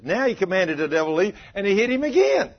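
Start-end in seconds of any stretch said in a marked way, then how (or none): none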